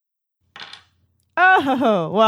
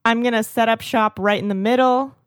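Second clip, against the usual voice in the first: usual speaking voice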